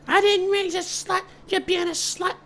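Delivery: very high, slow shrieking mother; scolding mom. Acted. Mother berating her pregnant daughter, very high.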